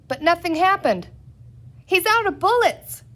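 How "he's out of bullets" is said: high, loud triumphant cry; cried out. A narrator's reading. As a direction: yell it to the mob. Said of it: "cried a third man".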